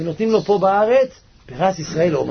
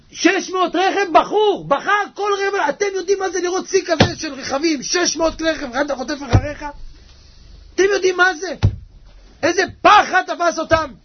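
urgent, raised sermon voice; fervent preaching; 11 semitones higher than usual. Real, not acted. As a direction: build alarm with driving, impassioned preaching. Preaches at full force.

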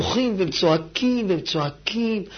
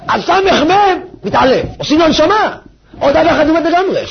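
high, loud impassioned sermon; preacher at full force; fiery preaching; 9 semitones higher, 5 dB louder than usual. Acted. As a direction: pound the point home.

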